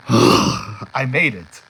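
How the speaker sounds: big exhale, then drawn-out words; slow, relieved gasp after a run; sigh of relief. Retold or imitated. Relief on reaching the terminal.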